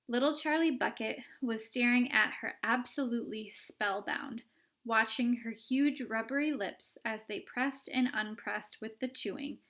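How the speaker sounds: usual speaking voice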